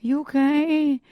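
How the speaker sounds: high, slow, drawn-out mock concern; cooing sympathy. Therapist voicing the pity clients look for, cooing. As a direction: coo it like pitying a fragile client. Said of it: "they're looking for me to say".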